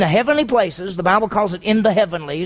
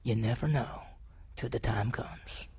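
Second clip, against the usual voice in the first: hushed whisper; 8 semitones lower, 14 dB quieter than usual. Real, not acted. Sobering aside.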